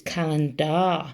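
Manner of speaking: "calendar" ending in a full, drawn-out DAR; spelling pronunciation. Shown as a demonstration. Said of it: "A R on the end".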